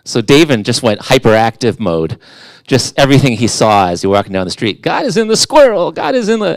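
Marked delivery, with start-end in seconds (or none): 4.8-6.5 put-on high, excited little-kid voice; excited child. Dad imitating his son spotting God everywhere, high and excited. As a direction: gush it out like a hyperactive kid.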